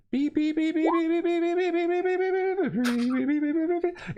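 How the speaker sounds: high rapid beeping scanner noise; scanner beeps. Retold or imitated. GM imitating the guard's scanner sweeping the captain, high beeps. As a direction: beep like a handheld scanner, high.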